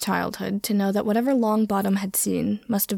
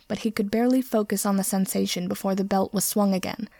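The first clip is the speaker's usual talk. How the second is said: plain reading voice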